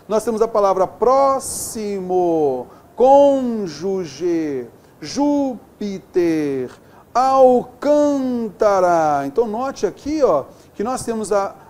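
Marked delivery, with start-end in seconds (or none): 0.9-9.3 drawn-out cônjuge, Júpiter, Alcântara; slow example words. Shown as a demonstration. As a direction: stretch each word to show its stress. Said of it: "Acentuamos todas as proparoxítonas". (palavras bem devagar)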